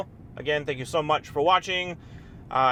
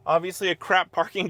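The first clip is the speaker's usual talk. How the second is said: ordinary talk, nothing marked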